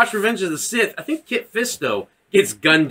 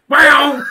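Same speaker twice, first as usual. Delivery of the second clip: high, loud saber swish; imitated lightsaber; 10 semitones higher, 8 dB louder than usual. Guessing how Darth Maul was cut, imitated lightsaber noise. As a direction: mouth a quick saber swing.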